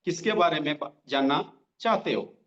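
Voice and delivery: drawn out, every word spaced with pauses; slow dictation. Shown as a demonstration. Trainer dictating the reply line for notes, slowly. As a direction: dictate it slowly for note-taking. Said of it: "slow slow बोल रहा हूँ".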